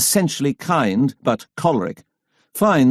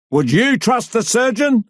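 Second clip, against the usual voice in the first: higher jeering cry, hitting you; cry from the crowd; 10 semitones higher than usual. A narrator's reading. Heckler in the crowd jeers at Fisher, high jeering cry. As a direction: shout it from the back, jeering. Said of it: "a voice cries out".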